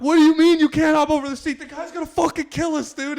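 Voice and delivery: high, loud incredulous outburst; high-pitched exasperated shout, acted out; baffled yelling. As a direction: re-enact yelling at a useless friend in disbelief. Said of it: "I'm, like, baffled".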